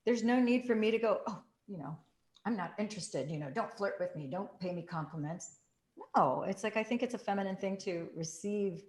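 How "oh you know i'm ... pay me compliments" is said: much softer, mock dismissive; cold rebuff. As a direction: brush them off quietly.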